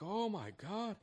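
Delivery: high mocking "oh my God"; mock shocked gasp. Commentator mocking shocked supporters, high and breathy. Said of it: "maga Republicans are gonna be like".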